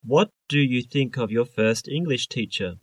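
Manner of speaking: ordinary talk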